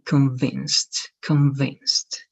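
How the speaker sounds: convinced said slowly as "kuhn-VINST", clear short i; clear pronunciation of convinced. Shown as a demonstration. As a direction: model convinced for the student, ending in a crisp "nst". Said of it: "just like E".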